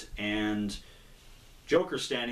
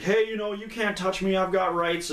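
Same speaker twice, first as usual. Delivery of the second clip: whiny painted-up thug; 9 semitones higher than usual. Retold or imitated. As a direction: squeal out your rights in mock protest. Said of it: "The guy's like".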